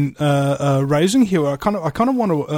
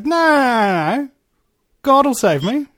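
long, high-pitched no, sure of himself; confident refusal; 8 semitones higher than usual. Retold or imitated. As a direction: refuse help in a raised, certain voice. Joke's stranded man refuses last rescue.

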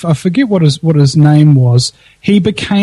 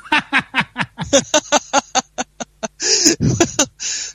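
hearty laugh; 7 semitones higher, 7 dB quieter than usual. Real, not acted. Laughing at own joke.

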